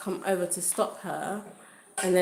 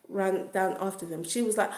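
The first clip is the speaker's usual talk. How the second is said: usual speaking voice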